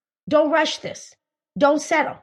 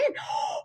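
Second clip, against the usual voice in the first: mock gasp; 10 dB quieter than usual. Seeing a handsome guy.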